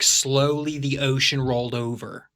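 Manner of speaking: slow American accent drill; measured American voice-class reading. Shown as a demonstration. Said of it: "we were studying American accents".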